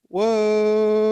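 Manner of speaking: high, loud amazed whoa; surprised whoa. Real, not acted. Reacting to fight card.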